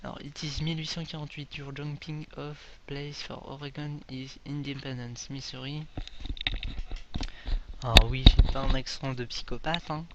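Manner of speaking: ordinary delivery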